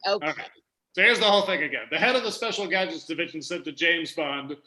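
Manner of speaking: ordinary delivery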